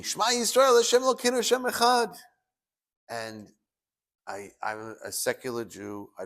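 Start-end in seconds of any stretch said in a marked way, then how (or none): 0.0-2.2 high, loud imitation of a screamed prayer. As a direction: cry it out loud, mimicking the screamer. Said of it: "always screaming".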